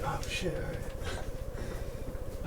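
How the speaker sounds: whispered, cowed reply; hushed scared agreement; meek whisper. Retold or imitated. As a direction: shrink back and give in. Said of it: "And I'd be like".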